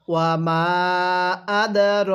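ওয়া মা আদর stretched slowly, each syllable held; slow teacher recitation with the long vowels held; slow recitation demo. Shown as a demonstration. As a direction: model reading for students to repeat. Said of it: "আমার সাথে পড়ুন".

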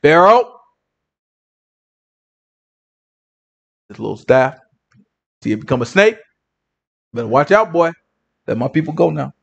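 low, measured command with pauses; confident Moses. Acted. Moses confronting Pharaoh with his staff, low and confident. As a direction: play it cool and strong, deep down low. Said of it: "I'm gonna tell him just like this".

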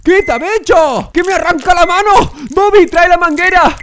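high, loud comic panic; panicked yelling. Acted. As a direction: scream for help as your hand is yanked. Hand being torn off, calls assistant.

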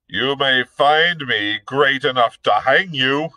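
bullying officer voice, loud and scornful; blustering captain. Acted. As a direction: bark it like a brutish dragoon. Hobart threatening.

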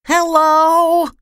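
very high, held-out little call; small voice at full stretch; tiny shout. A narrator's reading. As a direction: shout as a tiny person would, high but not very loud. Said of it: "Mister Small shouted at the top of his voice".

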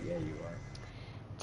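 breathy whispered tease; whispering. Real, not acted. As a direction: whisper it, barely voiced. Teasing teammate about bleeding out.

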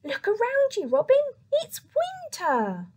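high, loud squeaky mouse voice. Acted. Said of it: "squeaked the mouse".

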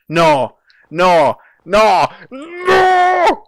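loud no's climbing to a high wail; escalating shouts. Real, not acted. Repeated failure in game.

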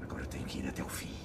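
fast breathless whisper. Acted. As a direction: hurry it out under your breath. Resolve while falling. (sussurro rápido)

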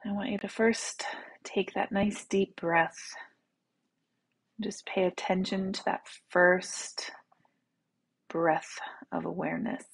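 soft, unhurried meditation voice; guided meditation. Real, not acted. Teacher leading listeners to relax in the right now, soft meditation voice. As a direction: guide them gently into the body, with long pauses.

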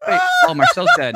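ordinary delivery

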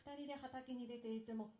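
usual speaking voice

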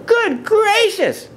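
very high, drawn-out astonishment; startled, squealed exclamation; gasped surprise. A narrator's reading. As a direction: exclaim it high and slow, shocked. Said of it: "gasped Maisie".

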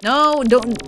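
ordinary delivery

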